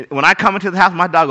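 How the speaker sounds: normal talking voice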